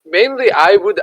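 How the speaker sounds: normal talking voice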